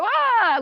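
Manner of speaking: a sudden high yelp of alarm; mock scream. Parent shrieking at the roommate who loves cocaine, a mock scream.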